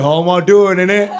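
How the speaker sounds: exaggerated British street accent; Peaky Blinders accent. American replies to British greeting, bad Peaky Blinders accent. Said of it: "we reply with Peaky Blinders on meth".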